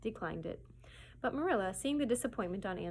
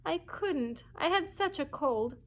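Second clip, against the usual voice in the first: tearful; 7 semitones higher, 3 dB louder, slower than usual. Acted. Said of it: "dissolved into tears".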